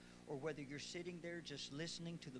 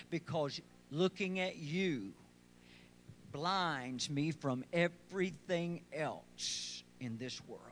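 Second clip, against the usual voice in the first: "because looking at you" stretched out very slowly and louder; slow, intense preaching; 10 dB louder, slower than usual. Acted. Pastor slows down and presses the words to the congregation, louder and drawn out. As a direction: draw each word out slowly, as if in awe. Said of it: "But why are you talking like this?".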